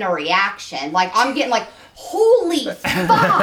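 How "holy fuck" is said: drawn-out, slow mock moan of pleasure. Friend retelling his reaction in a mock slow moan. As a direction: stretch it out, half laughing.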